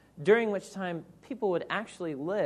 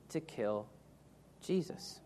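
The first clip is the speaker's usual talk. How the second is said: hushed; 3 semitones lower, 9 dB quieter than usual. Acted. The leaders wanted to kill him.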